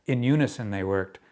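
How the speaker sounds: projected, measured verse delivery; deliberate, emphatic poem recital; poetry reading. Acted. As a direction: tell the poem out to a room, pausing between phrases. Reads own poem aloud.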